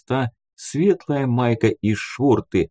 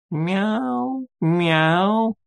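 high, drawn-out meows; two sustained meows in a high voice; cat meowing; 11 semitones higher than usual. Acted. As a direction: imitate a cat with long, high-pitched mews. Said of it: "раздалось над моим ухом".

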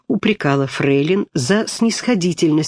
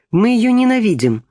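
ringing shout; 7 semitones higher than usual. A narrator's reading. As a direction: shout it out defiantly. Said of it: "звонко крикнула Бельская".

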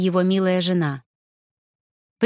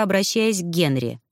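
ordinary delivery

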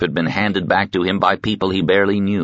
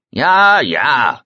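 put-on gruff, loud old woman calling through a door; 7 semitones higher, 5 dB louder than usual. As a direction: bark it out gruffly, high and loud. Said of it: "a gruff voice sounded from within".

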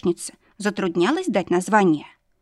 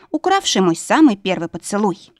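usual speaking voice

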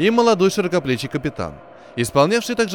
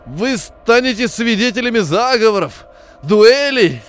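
strained wheeze; 7 semitones higher, 6 dB louder, slower than usual. Acted. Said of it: "просипел он сдавленным голосом".

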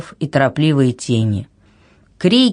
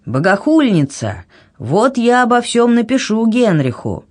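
indignant threat rising in pitch; scold, then threaten with raised pitch; mild shout; 7 semitones higher than usual. A narrator's reading. King threatening Anna over the railing, mildly shouted. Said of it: "закричал Казимир".

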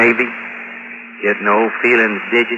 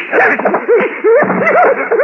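maniacal laugh. Acted. Joey unhinged with gun.

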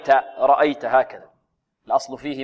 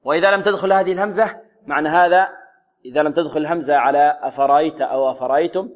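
normal talking voice

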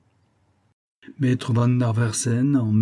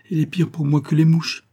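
higher-pitched, lightly acted grumbling; exasperated complaint; 6 semitones higher than usual. A narrator's reading. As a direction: narrator voicing a vexed housewife. Wife complains about the dreaded visitor.

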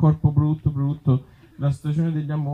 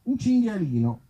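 slow, much higher fairy-tale opening; drawn-out once-upon-a-time; storybook voice; 12 semitones higher than usual. Acted. Comic opens the boar story slow and high.

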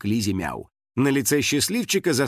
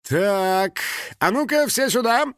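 high, commanding call to the crew; stern director; 9 semitones higher, slower than usual. A narrator's reading. Director summoning everyone over the phone, stern. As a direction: order everyone over, voice pitched right up. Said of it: "строгий голос режиссёра".

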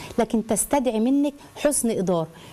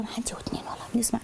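fast hushed whisper; a wife whispering urgently so the neighbours won't hear; 9 dB quieter, faster than usual. Retold or imitated. Voicing wife hushing husband.

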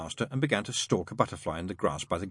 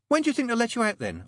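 put-on much higher, louder child voice; child's question; 13 semitones higher, 4 dB louder, faster than usual. A narrator's reading. Pepper asks grounded Adam about release, high child voice. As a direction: pipe up with a high-pitched question.

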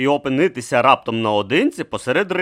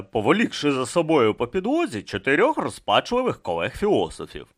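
ordinary delivery, nothing marked

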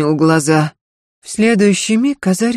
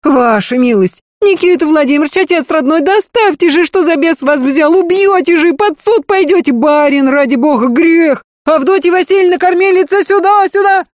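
high frantic lamenting crowd; wailing servants; 11 semitones higher, 4 dB louder than usual. A narrator's reading. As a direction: wail and plead over each other. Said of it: "наперебой причитали". (причитая)

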